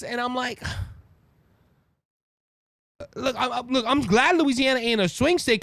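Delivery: ordinary delivery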